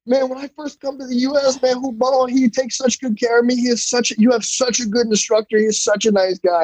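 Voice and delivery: a lightly voiced friendly Brazilian coach; opponent's voice. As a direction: gush about the instructor in his voice. Voicing opponent praising instructor.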